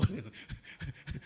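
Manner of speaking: short chuckle after a punchline; amused chuckle. Real, not acted. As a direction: chuckle at your own joke. Laughs at own joke's punchline.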